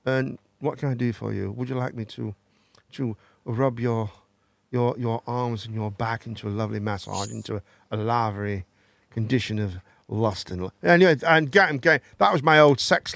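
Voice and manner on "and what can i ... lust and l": lower, softer seductive posh voice; mock sultry posh phone voice, softly lower; posh sex line voice. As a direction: purr it like a posh phone flirt. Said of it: "and I go".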